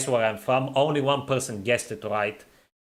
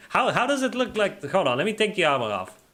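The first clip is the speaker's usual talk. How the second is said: ordinary talk, nothing marked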